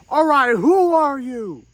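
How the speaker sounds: loud, slow demanding challenge; mock angry mourner demanding answers; confrontational guy. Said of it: "one guy comes up and goes".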